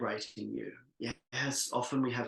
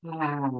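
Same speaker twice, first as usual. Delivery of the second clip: louder sighed-out ah; expressive released ah; 4 semitones higher, 5 dB louder than usual. Shown as a demonstration. Leader increasing the expression for the group, fuller released ah. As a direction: let more sound out on ah. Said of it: "increasing the expression".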